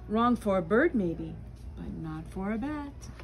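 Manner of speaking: ordinary delivery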